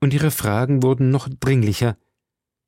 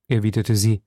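ordinary delivery

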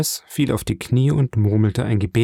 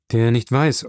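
ordinary delivery

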